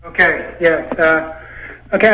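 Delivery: ordinary talk, nothing marked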